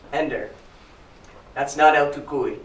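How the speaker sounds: level, unhurried reassurance; calm mother. Acted. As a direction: settle the child down, evenly. Mother calmly corrects frightened child.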